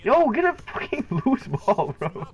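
excited yell. Real, not acted. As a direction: yell at the screen. Excited yelling at game.

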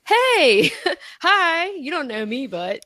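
high singsong "hey", easing back down; mock-friendly greeting. Newcomer interrupts the online agreement, mock-friendly. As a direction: pipe up brightly, then settle toward normal.

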